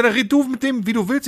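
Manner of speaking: high, worked-up quote without shouting; retold exasperation. Handing the talking to his friend, high retold exasperation. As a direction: imitate yourself arguing, voice pitched right up.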